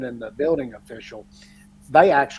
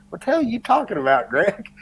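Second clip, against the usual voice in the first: higher, fast incredulous retort; mock disbelief; 6 semitones higher, faster than usual. Dad answers a surprise job offer from his friend, incredulous. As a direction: imitate a dad caught completely off guard.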